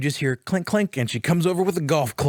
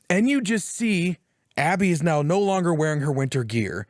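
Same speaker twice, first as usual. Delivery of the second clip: ordinary delivery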